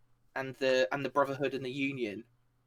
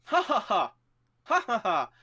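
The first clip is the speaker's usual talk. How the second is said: high, loud staged laugh in two bursts; hammed-up mocking laughter; acted cackle; 8 semitones higher, 5 dB louder than usual. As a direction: cackle triumphantly in threes. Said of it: "And you just hear".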